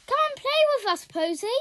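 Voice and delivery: put-on a child bursting with fun calling a friend over; excited call. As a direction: call out eagerly to come play. Pip calls Posy to play.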